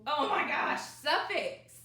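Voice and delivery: exasperated oh my gosh. Acted. Child says special, not suffix.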